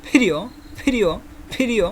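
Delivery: each ফিরিও opens on a heavy puff of air; thick pronunciation. Shown as a demonstration. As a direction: repeat ফিরিও with a thick, aspirated "ph". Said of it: "উচ্চারণটা একটু মোটা করে হবে".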